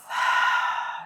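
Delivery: long open-mouthed sigh; demonstrated exhale. Shown as a demonstration. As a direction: breathe out audibly through the mouth. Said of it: "out through your mouth".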